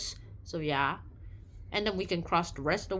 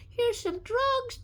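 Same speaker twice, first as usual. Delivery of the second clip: sly offer; 13 semitones higher, much slower than usual. Retold or imitated. Crowd bribing him.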